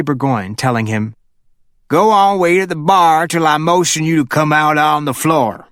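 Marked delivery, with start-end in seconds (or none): 1.9-5.7 a boss shooing his driver to the bar; boss giving orders. A narrator's reading.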